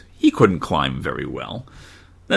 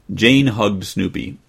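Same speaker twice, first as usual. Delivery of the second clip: usual speaking voice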